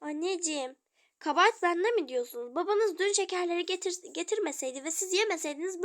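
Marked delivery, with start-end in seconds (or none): none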